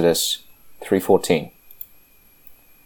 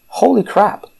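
shocked exclamation. Real, not acted. Shocked reaction to scripture.

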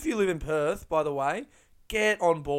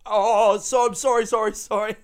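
high, loud, flustered sorry; panicked apology; 7 semitones higher, 8 dB louder than usual. Retold or imitated. Panicked apology after swearing on air.

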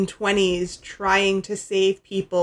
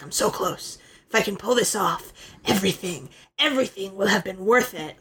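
a lightly voiced megalomaniac rant; villain monologue; 3 semitones lower than usual. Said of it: "crazy villain monologue shit".

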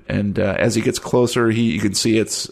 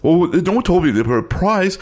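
high, eager excitement; excited; 8 semitones higher, 4 dB louder than usual. Acted. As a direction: squeal it with eager delight. NPC excited about prize.

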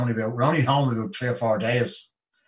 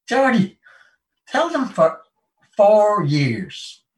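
calling out; 9 semitones higher, 4 dB louder, much slower than usual. Retold or imitated. Addressing the ranks.

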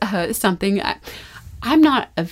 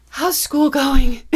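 out of breath; 5 semitones higher, 3 dB louder, slower than usual. Retold or imitated. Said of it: "I'm out of breath".

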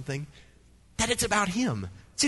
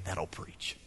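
whisper; 12 semitones lower, 10 dB quieter than usual. Acted. Preacher's catchphrase as an aside.

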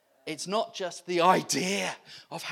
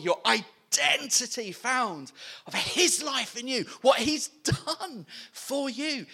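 ordinary talk

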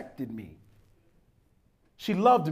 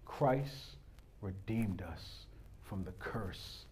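hushed preaching; 8 semitones lower, 14 dB quieter than usual. Acted. Quiet intimate preaching.

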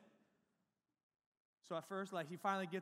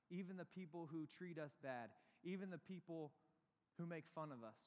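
earnest sermon; 2 semitones lower, 6 dB quieter than usual. Acted. Preaching the message softly.

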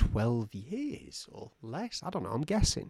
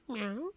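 high, soft little meow; small squeaky cat cry; 10 semitones higher, 7 dB quieter than usual. Acted. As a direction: imitate a cat quietly. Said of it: "and the cat goes".

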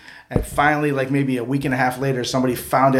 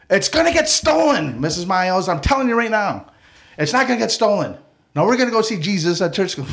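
put-on kid pleading; 7 semitones higher than usual. Kid arguing with teacher.

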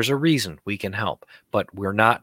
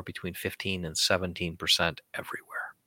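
whispered secret aside; whispering; 3 semitones lower, 10 dB quieter than usual. Real, not acted. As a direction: drop to a whisper. Confidential poll number.

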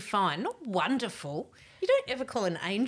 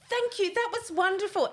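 higher gushing royal thank-you; 9 semitones higher than usual. Retold or imitated. Retelling what she said to the rock star, a gushing thank-you. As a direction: pour on the gracious thanks. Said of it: "I turned into fucking Lady Diana".

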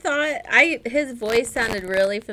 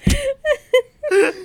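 laughing; 10 semitones higher, 4 dB louder, faster than usual. Real, not acted. Laughing while talking.

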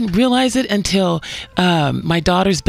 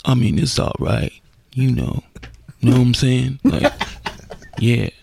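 impression of Brittany Griner; 11 semitones lower than usual. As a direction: keep the voice low and lazy. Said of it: "So, Brittany, how's the nightlife".

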